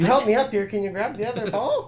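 high little rock creature voice; rock guy voice. Acted. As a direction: squeak it up like the tiny rock guy. Rock guy asks Ruth.

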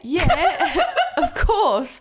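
high, laughing mock British; loud, giggly put-on British; British accent. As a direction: imitate a bluffed British accent. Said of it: "all still doing the accent".

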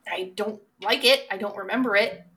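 ordinary talk, nothing marked

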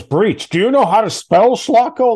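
high, loud retold yell over a typo; mock yell. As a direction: bellow it like the towering boss scolding. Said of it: "he was yelling at me over breach".

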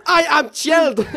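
high, slow, laughing teacher voice; teacher claiming calm, mimicked; mock indignant teacher. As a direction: imitate her insisting through laughter. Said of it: "she's going".

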